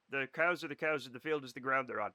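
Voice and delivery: ordinary talk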